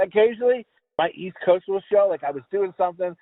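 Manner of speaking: ordinary delivery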